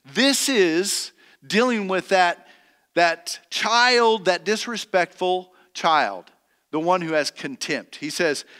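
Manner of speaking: ordinary delivery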